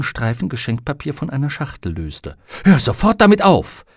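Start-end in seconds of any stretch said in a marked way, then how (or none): 2.6-3.9 loud, high scolding shout; sharp shout. Acted. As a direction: bark it out to stop him. Susanne scolds the raven.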